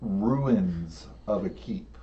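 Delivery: suspenseful storytelling, voice dropping; game master scene setting. Acted.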